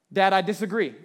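loud mock objection of a small child; playful impression of a contrary child; imitated five-year-old. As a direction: announce it firmly like a defiant little kid. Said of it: "a five-year-old".

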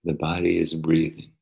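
soft, unhurried meditation voice; soft spa whisper. Acted. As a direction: ease them gently into stillness. Leads a guided meditation.

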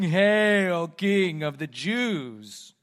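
drawn-out, mock-grand acclamation; loud hail, stretched slowly in ridicule. Soldiers kneeling before Jesus to ridicule him, mockingly. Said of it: "they mocked him, saying".

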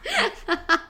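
cracking up mid-sentence; laughing through the words; trying not to laugh. Real, not acted. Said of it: "I'm losing it".

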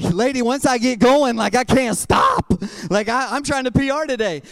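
gym-goer protests to the trainer, mock shouting. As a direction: push back loudly at the trainer. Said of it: "I'm like".

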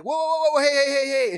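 high, loud string of warnings; re-enacted alarmed yell, high and loud; alarmed shout. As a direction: shout to stop someone. Retold alarm as kid lands near.

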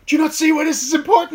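loud, high, exasperated yell at a friend; exasperated shout. Retold or imitated. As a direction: shout it in utter disbelief. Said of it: "we're like".

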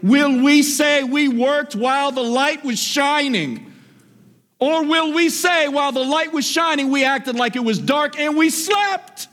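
high, rising preacher's cry; preaching at full force. Acted. Preacher pressing the church to work while light shines. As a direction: preach it up to the peak.

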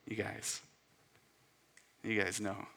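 low, soft quoted aside; quiet letdown. Retold or imitated. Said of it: "And Jesus is like".

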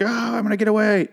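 high-pitched mock fleeing cry; mock panic. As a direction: imitate a frantic victim in a high voice. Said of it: "fighting for her life and being like".

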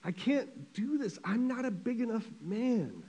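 high, soft acted sobbing plea; imitating a man weeping in despair. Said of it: "he started weeping".

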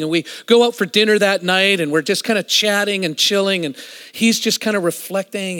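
normal talking voice

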